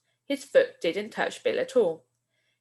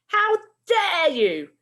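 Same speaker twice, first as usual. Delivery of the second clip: high, loud and slow outraged teacher; furious shout; 11 semitones higher, 6 dB louder, slower than usual. Acted. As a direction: bellow it at the two boys. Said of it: "shouted Missus Collins".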